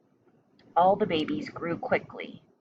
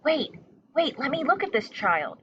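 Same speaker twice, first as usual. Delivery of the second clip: high, eager bat voice; urgent mother bat; 7 semitones higher than usual. A narrator's reading. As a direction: push through calling wait. Mother bat pushing through crowd.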